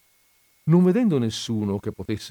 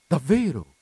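higher, curious question; interested; 6 semitones higher than usual. A narrator's reading. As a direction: show sudden interest. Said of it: "si interessò". (interessato, con curiosità)

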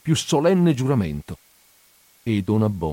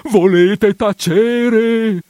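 much higher, louder and very slow cry; exasperated outburst; 11 semitones higher, 6 dB louder, much slower than usual. Acted. Priest shouting his housekeeper down, exasperated. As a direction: explode in desperation. Hushes her, second time.

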